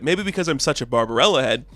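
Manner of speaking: ordinary delivery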